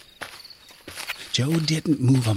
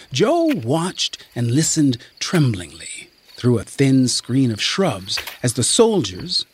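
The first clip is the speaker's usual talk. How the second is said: ordinary delivery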